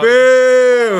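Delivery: loud, high drawn-out boo; mock jeering. Co-host heckles another over Titans, loud mock jeering. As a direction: heckle them with a big playful boo.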